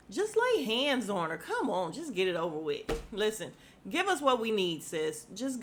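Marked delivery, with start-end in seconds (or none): none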